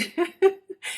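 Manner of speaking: short high-pitched laugh; a high, rueful little laugh. Real, not acted. Rueful laugh about bed rest.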